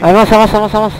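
loud urgent shout. Real, not acted. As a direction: bark the order to move. Urgent orders under gunfire.

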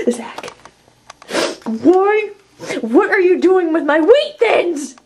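high, loud outraged yelling; shouting. Acted. Catching roommate Zach with the snacks, outraged shouting. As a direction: yell in betrayed outrage.

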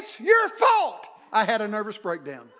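half-laughing, loud mock accusation; laughing mock outburst. Preacher reading his drama teacher's note, laughing mock outburst. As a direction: blurt it out loud through a laugh.

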